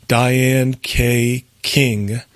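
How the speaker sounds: very slow, name spaced out word by word; slow dictation. Real, not acted. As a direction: dictate the name slowly for someone writing it down. Repeats address for listeners writing down.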